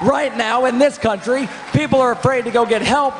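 high impassioned shout. Real, not acted. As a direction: rant it at the crowd. Candidate shouting his speech.

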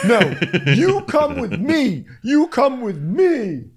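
very high, slow mock command; imitating Gina. Friend imitating Gina, very high and slow. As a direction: drag the words out, high and bossy. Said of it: "Gina's like".

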